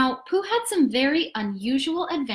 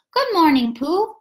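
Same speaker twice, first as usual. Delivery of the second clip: put-on higher, bright boy voice; 6 semitones higher, 6 dB louder than usual. A narrator's reading. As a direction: greet like a cheerful boy. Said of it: "said Christopher Robin".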